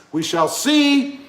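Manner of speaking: ordinary talk, nothing marked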